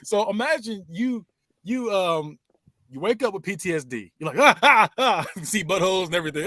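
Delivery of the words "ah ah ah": mock scream. Acting out PTSD wake-up.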